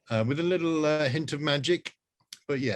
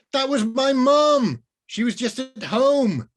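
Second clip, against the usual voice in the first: much higher, louder exasperated shout; angry girl; 13 semitones higher, 8 dB louder, slower than usual. Acted. Rose shouting at the wizard about her mum. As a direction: shout it in disbelief.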